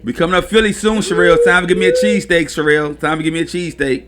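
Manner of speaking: loud call to a friend. Real, not acted. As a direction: shout it out to Sherelle. Calling out to a friend.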